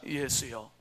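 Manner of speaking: soft call. Shown as a demonstration. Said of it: "한번 합시다".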